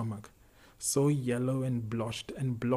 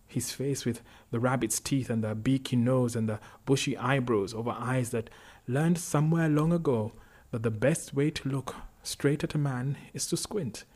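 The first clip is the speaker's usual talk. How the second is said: ordinary delivery, nothing marked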